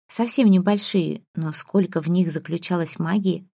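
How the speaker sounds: ordinary delivery, nothing marked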